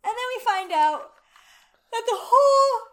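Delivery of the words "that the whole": loud, stretched words through a yawn. Real, not acted. Co-host revealing the town's secret while yawning. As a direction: keep talking as a yawn takes over. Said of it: "Stop yawning".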